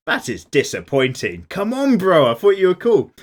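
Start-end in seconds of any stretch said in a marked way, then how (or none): 1.5-3.1 loud, high-pitched playful indignation; mock outrage. Host scolding a viewer over a dubbed preference, mock-outraged. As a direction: protest as if let down by a mate.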